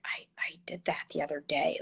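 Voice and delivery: a soft, breathy whisper as a worried parent; imitating a parent whispering; hushed parent confession. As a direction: confess it under your breath, mildly ashamed. Host voices a worried parent.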